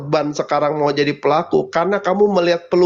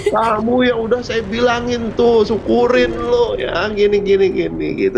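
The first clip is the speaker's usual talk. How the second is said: gloating taunt; 7 semitones higher than usual. Retold or imitated. Acting out a gloating partner.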